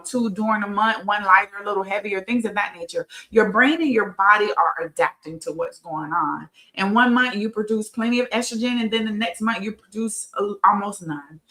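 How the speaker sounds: ordinary talk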